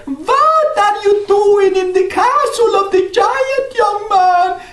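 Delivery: put-on very high, slow old woman's voice; old crone. Old woman in the castle questions Jack, very high and slow.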